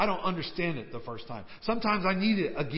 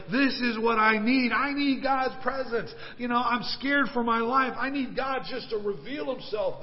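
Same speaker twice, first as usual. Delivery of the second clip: raised, higher-pitched eager plea; earnest, pitched-up yearning; urgent longing; 6 semitones higher, 4 dB louder than usual. Retold or imitated. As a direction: act out a needy man's hopeful thoughts. Voices Elijah's eager, needy thoughts.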